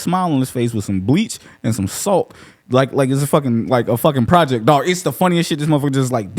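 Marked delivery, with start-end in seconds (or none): none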